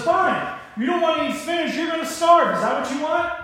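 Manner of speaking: high, loud acted exasperation; fed-up parent. Parent to toddler refusing spinach, angry and fed up. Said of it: "slams his fist on the table".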